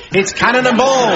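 high, loud showman's introduction; big announcement. Real, not acted. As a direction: announce them like stars arriving. Host announces the legendary guests.

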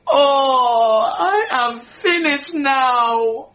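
crying oh, then slow, drawn-out despair; sobbing lament. Acted. Maid to Ra, mourning that she is finished, slow tearful wail.

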